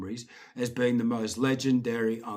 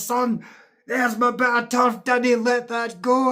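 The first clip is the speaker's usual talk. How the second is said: uncle's voice; 9 semitones higher, 9 dB louder than usual. Retold or imitated. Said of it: "he says".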